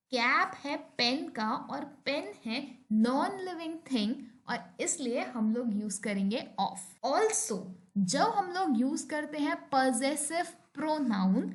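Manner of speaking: ordinary talk, nothing marked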